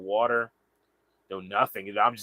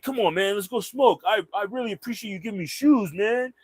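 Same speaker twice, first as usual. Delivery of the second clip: eager stranger; 9 semitones higher than usual. Retold or imitated. Stranger urges the narrator to go smoke, high eager voice. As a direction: coax him along, pitched up. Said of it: "he's like".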